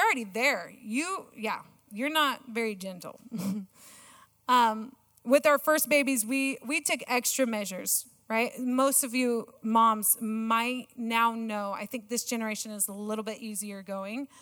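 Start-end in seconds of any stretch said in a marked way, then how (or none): none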